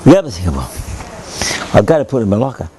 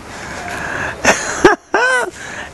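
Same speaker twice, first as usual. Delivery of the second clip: laughing. Real, not acted. Laughing at the late arrival.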